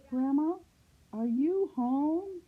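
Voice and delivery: put-on drawn-out high girlish call. A narrator's reading. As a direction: call questioningly into the house in a high voice. Girl calls into grandmother's house.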